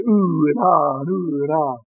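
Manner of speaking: mock cooing, drawn-out oohs and ahs; lightly imitated admiring coos; imitated oohing women. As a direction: mimic women fussing over children. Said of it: "all these women are going".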